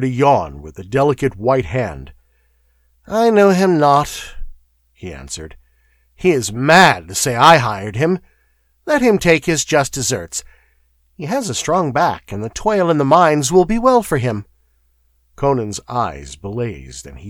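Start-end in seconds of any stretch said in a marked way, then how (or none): 3.1-14.5 bored nobleman. Acted. Noble dismisses Conan with a yawn.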